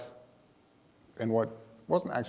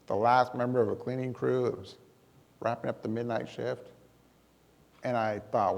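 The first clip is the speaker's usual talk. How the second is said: ordinary talk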